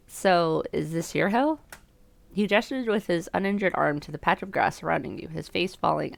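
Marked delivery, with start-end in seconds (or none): none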